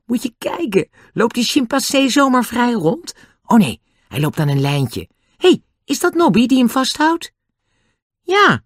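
high-pitched, eager excitement; excitedly pointing out a sight. A narrator's reading. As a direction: exclaim it like a thrilled child. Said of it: "zei Annie opgewonden".

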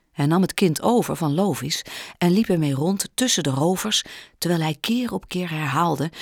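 usual speaking voice

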